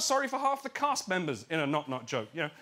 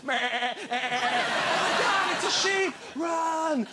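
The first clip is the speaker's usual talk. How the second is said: mock panic; 10 semitones higher, 3 dB louder, much slower than usual. People panicking at paratrooper sheep.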